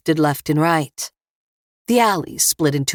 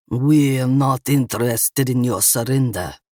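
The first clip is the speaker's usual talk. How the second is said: lower-voiced Russian gunman; thick Russian accent; 3 semitones lower than usual. A narrator's reading. Russian gunman turns down Eva's surrender, thick Russian accent. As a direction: refuse coolly in a Russian accent. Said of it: "a man with a thick Russian accent".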